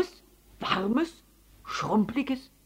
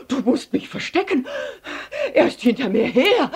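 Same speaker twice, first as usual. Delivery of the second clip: panting, louder and higher plea; gasping for air; 9 semitones higher, 7 dB louder than usual. A narrator's reading. Elephant begging Paul to hide him, gasping for air. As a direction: pant it out, breathless and hunted. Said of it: "er jappte nach Luft".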